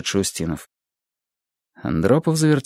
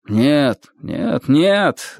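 echoing chorus; 6 semitones higher, much slower than usual. A narrator's reading. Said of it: "дружным эхом раздалось".

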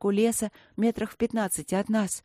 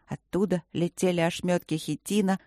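ordinary delivery, nothing marked

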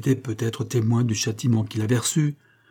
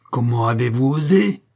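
indignant outburst, louder, rising on osé; furious cry; 5 dB louder than usual. A narrator's reading. Aurora cries out at the groom, furious. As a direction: confront him, voice full of outrage. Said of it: "s'écria Aurora".

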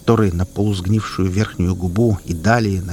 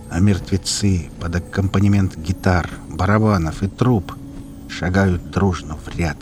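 usual speaking voice

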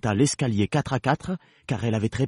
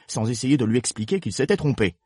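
usual speaking voice

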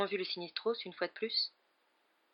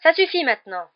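higher, much louder exclamation; exasperated outburst; 8 semitones higher, 12 dB louder than usual. A narrator's reading. Hermione stopping Trelawney's prophecy, loud exasperated exclamation. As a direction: cut her off hard. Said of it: "s'exclama Hermione".

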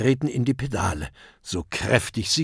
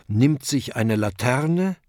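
usual speaking voice

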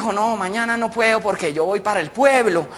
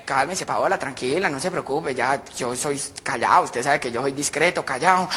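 low, discreet murmur; calm someone down confidentially; reassuring; 6 semitones lower, 4 dB quieter than usual. Acted. José promises Paola he is discreet, reassuring.

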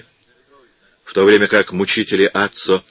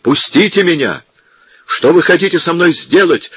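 much higher and louder frantic cry; desperate shout; 10 semitones higher, 5 dB louder than usual. A narrator's reading. Captive screams at the monks dragging him, desperate. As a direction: scream to break free. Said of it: "крикнул Аццо и попробовал".

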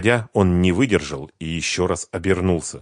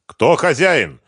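sharp, loud and higher demand; the official presses the family for the landowner, voice raised; 9 semitones higher, 9 dB louder, slower than usual. A narrator's reading. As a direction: raise your voice on the owner question. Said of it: "повысил голос Шарипов".

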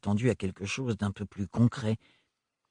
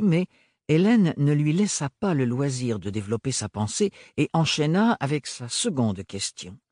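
ordinary talk, nothing marked

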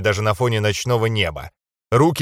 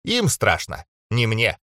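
ordinary delivery, nothing marked

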